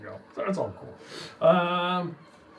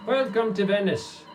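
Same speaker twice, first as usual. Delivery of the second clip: dock official greeting; 7 semitones higher, 4 dB louder than usual. Acted. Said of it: "The individual at the dock says".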